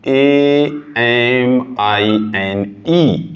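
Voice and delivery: very slow spelling of ए एम आई एन ई, each letter apart; teacher spells the suffix ए एम आई एन ई for the class, very slowly; spelled out letter by letter. Shown as a demonstration. Dictate ए एम आई एन ई letter by letter. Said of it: "और इसका suffix name है".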